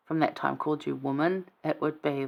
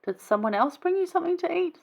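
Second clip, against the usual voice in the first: confused disciples; 13 semitones higher than usual. Retold or imitated. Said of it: "And they're like".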